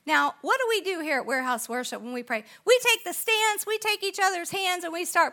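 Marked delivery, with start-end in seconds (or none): none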